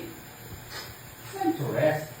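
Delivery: slow hushed whisper. Real, not acted. Mother whispering her toddler toward sleep, slow and hushed. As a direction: breathe it out softly and slowly. Mother settling child for bed.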